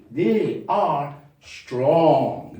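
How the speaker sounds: they are strong, very slow; slow model read; slow dictation. Shown as a demonstration. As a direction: stretch it for the class. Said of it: "modelling sentence".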